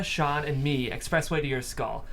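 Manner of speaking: usual speaking voice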